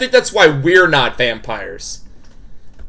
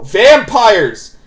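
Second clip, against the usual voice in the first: high, loud exclamation; excited outburst; 8 semitones higher, 5 dB louder, slower than usual. Real, not acted. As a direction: call it out triumphantly, high and drawn out. Calls out a plot guess.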